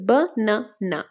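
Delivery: "Banana" split into three beats, ba-na-na; syllable by syllable. Shown as a demonstration. Teacher giving a trisyllable example, "banana" said syllable by syllable. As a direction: say "banana" with a gap after each syllable. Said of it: "তিনটা পার্টে হলে ট্রাইসিলেবল".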